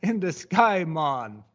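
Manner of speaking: high mock Jamaican drawl; lightly hammed Jamaican voice; trailing off the Jamaican parody; mock Jamaican accent. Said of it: "Jamaican adjacent accent".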